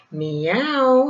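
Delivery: cat in a tree calling down, meowing; cat's meow. A narrator's reading. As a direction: call down like a cat. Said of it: "he called down".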